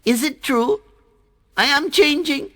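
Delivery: very high, loud cheering; water drop thrilled to be changing, very high; very excited. Acted. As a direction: burst out with joy. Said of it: "Jal, very excited".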